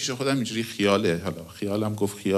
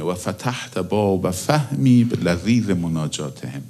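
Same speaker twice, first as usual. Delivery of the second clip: prayer recitation; 5 dB louder, slower than usual. Acted. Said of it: "دنبال اینه".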